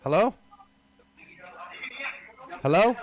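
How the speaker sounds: loud, high, questioning hello; calling out hello. Real, not acted. Caller checking someone is on the line, calling out. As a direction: call loudly down the line, pitch rising.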